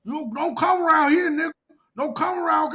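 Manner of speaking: high, loud mock threat; mocking angry fan. Mocking fans threatening him in his messages. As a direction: squeal it like a fan talking tough. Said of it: "what it was is like".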